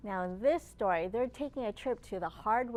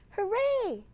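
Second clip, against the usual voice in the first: big high cheer, louder; 10 semitones higher, 7 dB louder than usual. Acted. Librarian leading kids, cheering hooray loud and high.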